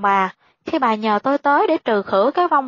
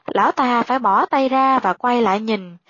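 usual speaking voice

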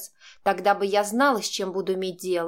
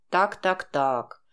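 drawled; much slower than usual. A narrator's reading. Max surprised by her real resistance, drawled. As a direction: draw it out, sizing her up. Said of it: "протянул он".

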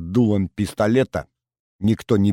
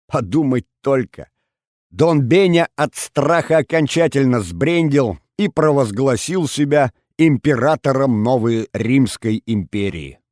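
ordinary delivery

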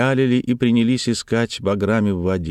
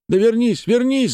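high urgent call from afar; high, insistent cries; shouting. A narrator's reading. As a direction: call someone back across the water. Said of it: "закричали с берега".

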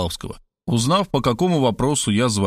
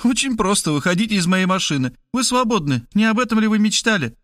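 higher, bluntly cheerful woman; higher woman's voice, tactless and chirpy; brash Markhaleva; 9 semitones higher than usual. A narrator's reading. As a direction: drop the bad news breezily. Markhaleva dismissing him.

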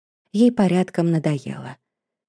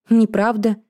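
mildly indignant objection, raised in pitch; higher, mildly affronted denial; 4 semitones higher, 3 dB louder than usual. A narrator's reading. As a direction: object with a touch of outrage. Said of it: "возмутилась Эдисон".

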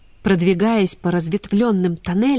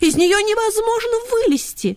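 put-on high, light girlish voice; young girls' voice. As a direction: speak like a young girl. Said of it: "ответили девочки".

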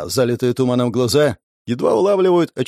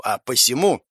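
ordinary delivery, nothing marked

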